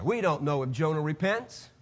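usual speaking voice

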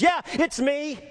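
high-pitched, impassioned owning up; earnest confession. Acted. Contrite heart admitting fault to God, impassioned confession. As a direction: confess it fervently, voice lifted high. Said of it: "a heart that says".

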